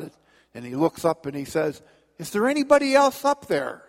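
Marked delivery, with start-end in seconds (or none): 2.2-3.8 high, frantic little voice; panicked punchline. Acted. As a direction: squeak it out in panic. Punchline, man asks desperately.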